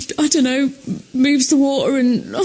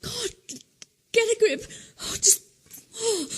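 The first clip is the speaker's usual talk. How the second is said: ordinary delivery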